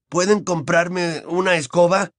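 high, slow hopeful plea; hopeful boy. A narrator's reading. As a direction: draw out a hopeful request to mum. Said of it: "aventuró Ron, esperanzado". (petición esperanzada de niño, lenta y aguda)

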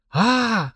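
high, voiced "ah" of amazement; amazed gasp. A narrator's reading. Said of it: "ахнула Машка".

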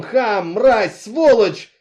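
loud furious screaming of insults. A narrator's reading. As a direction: scream them at him at full force. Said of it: "С криком".

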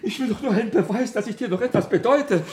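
high, loud, laughing urgency; laughing pleading. Acted. Husband pleading for proof of love, high and laughing.

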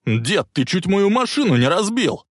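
high, indignant shout; yelling. A narrator's reading. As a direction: yell it out in outrage. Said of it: "заорал Биф-младший".

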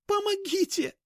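very high, desperate shriek; screaming for help. Acted. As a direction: cry out for help at full pitch. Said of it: "завопила Аня изо всех сил".

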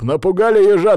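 loud, high, defiant jeer; scornful exclamation. A narrator's reading. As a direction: scoff back at the threat. Филипп насмехается над угрозой. (насмешливо, громко и с вызовом)

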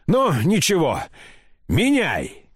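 high, resolute order; decisive cry. A narrator's reading. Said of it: "крикнула она решительно".

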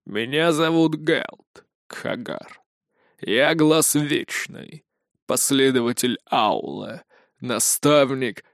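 slow, rasping, broken voice; hoarse broken voice. A narrator's reading. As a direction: unpleasant cracked rasp. Hoarse stranger keeps speaking.

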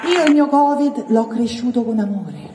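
usual speaking voice

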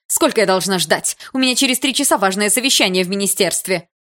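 loud, exasperated complaint; agitated, self-important grumbling; nervous impatience. A narrator's reading. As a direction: complain edgily, pressed for time. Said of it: "нервно спросила Екатерина Михайловна".

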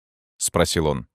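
ordinary delivery

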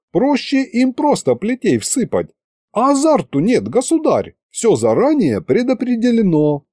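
high, polite archivist. A narrator's reading. Archivist explains.

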